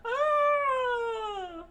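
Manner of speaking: very high, loud panicked shriek; crowd scream. A narrator's reading. Patrons panic at the attack, high loud shriek. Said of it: "all the patrons screamed".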